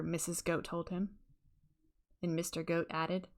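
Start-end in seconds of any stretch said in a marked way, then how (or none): none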